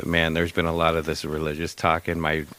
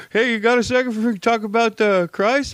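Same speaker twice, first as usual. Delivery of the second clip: high, loud mock evangelist; mock preacher voice; 5 dB louder than usual. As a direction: ham up a pushy preacher. Coworker mocking preacher pitch.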